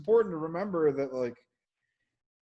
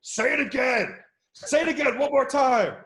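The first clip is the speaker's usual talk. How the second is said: high, loud yelled command; director yelling; 10 semitones higher, 6 dB louder than usual. Retold or imitated. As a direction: yell it from off set. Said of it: "Pete Berg is off set going".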